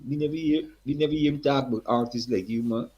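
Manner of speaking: put-on high, hearty Guyanese accent. Eddie's father greets the young singer in a high Guyanese accent. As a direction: greet him like an old Guyanese man. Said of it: "Strong accent again".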